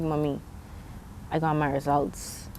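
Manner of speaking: hushed, subdued quote of herself; subdued retelling. Retold or imitated. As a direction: softly recount telling mom, voice dropped. Breaking bad news to her mother.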